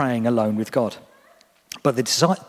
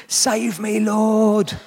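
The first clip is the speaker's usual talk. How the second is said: high, panicked cry for rescue; a sinking man's cry for help; a desperate high plea; 8 semitones higher, much slower than usual. A narrator's reading. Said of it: "he cried out".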